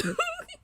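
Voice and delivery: high squealing laugh; burst of laughter. Real, not acted. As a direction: let out a shrieking laugh. Laughs at own reaction.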